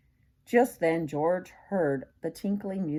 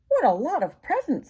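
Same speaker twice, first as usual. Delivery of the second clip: put-on higher, louder child's delight; Betsy's delight; 9 semitones higher, 5 dB louder than usual. Betsy at the party admiring presents, high and bright. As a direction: gasp at the gifts. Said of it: "said Betsy".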